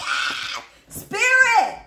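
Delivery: high, very loud exasperated shout; mock yell. Shown as a demonstration. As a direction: burst into a hammed-up scream. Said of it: "say it like this".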